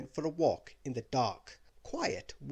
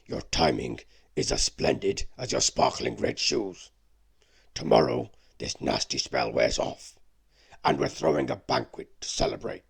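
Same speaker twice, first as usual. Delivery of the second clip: lower, louder beast voice, hopeful; beast inviting; 11 semitones lower, 8 dB louder than usual. Acted. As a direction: invite her as a big beast. Beast invites her to banquet.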